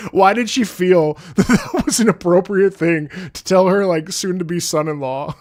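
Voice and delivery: high and loud through laughter; laughing disbelief. Real, not acted.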